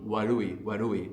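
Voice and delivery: "warui" with the r between an R and an L; warui with soft r. Shown as a demonstration. Teacher modelling "warui" for the learner, the r between R and L. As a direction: say "warui", middle sound halfway between R and L. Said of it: "between an R and an L".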